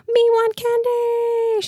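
put-on very high, slow wailing little-kid demand; sobbing toddler demand. A narrator's reading. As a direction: wail it out like a meltdown. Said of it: "she declares loudly and sobbing".

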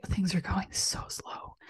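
soft, breath-only complaint about sluggishness; hushed, worn-out whisper; mock weary. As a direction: whisper it as if drained by the wait. Voicing feeling stuck and sluggish.

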